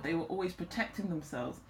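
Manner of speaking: normal talking voice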